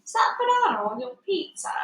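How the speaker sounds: put-on high, curious kid's question; classmate's voice. A narrator's reading. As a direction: voice Norman, a different boy. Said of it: "Norman Watson nudges me and says".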